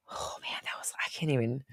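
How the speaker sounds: breathless whisper, fighting back tears; choked-up whisper. Real, not acted. Streamer overcome while talking about meditating, whispering. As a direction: whisper through real emotion. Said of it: "I got emotional".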